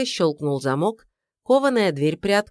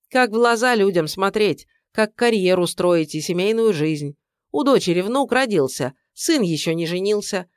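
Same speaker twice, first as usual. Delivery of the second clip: angry tirade; 3 semitones higher than usual. Acted. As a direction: lay into him furiously. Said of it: "тирада готова".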